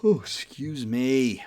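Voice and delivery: slow, drawn-out words through a yawn; real big yawn while talking. Real, not acted. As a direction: apologise mid-yawn, stretching the vowels. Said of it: "I can't stop yawning".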